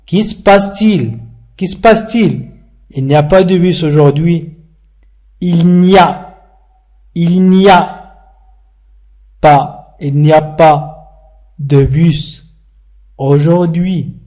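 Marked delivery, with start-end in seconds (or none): 0.1-2.5 Qui se passe-t-il? clear, "ki s pastil"; correct question. Shown as a demonstration. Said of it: "ولكن نقول". 5.4-14.2 very slow, chunk by chunk; slow chunked model. Shown as a demonstration. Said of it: "teacher breaks sentence apart".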